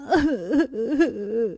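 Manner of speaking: soft broken sobbing; weeping child beside mother; child's sob. Acted. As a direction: sob quietly. Child weeps over dead mother.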